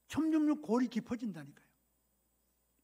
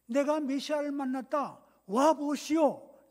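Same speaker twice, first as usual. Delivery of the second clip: loud, higher calling out; excited proclamation; 5 semitones higher, 7 dB louder, slower than usual. Acted. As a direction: summon everyone with the big news, drawing out the call. Converted woman calls to townspeople. (크게 외쳐 알리는 목소리)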